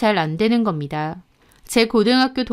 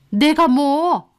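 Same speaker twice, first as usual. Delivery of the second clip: higher, slow, drawn-out retort; defensive mother-in-law; 5 semitones higher, 3 dB louder, much slower than usual. Acted. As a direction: act affronted at being accused. (발뺌하는 시어머니 목소리)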